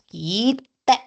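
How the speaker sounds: "kite" with a long held "i", "kiii-te"; slow "kiiite"; long vowel in kite. Shown as a demonstration. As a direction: draw out the "i" of "kite" before a short "te". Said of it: "los tres kite que hay".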